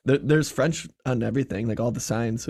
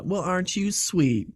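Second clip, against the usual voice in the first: higher, slow, sugary Southern comeback; sweet Southern shade; 7 semitones higher, slower than usual. Retold or imitated. Comeback to a sweet jab, passive-aggressive Southern sweetness. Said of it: "throwing mad shade in the sweetest way".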